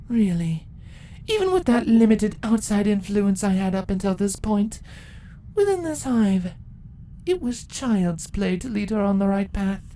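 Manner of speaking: proud empress telling her victory; ancient empress. Acted. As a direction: gloat over the long wait. Empress monologue.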